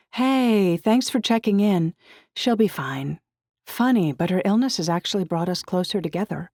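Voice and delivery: lightly acted chatty girlfriend; upbeat friend Georgie. A narrator's reading. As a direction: lift the voice for a friend's reassuring reply. Voices Georgie's text message.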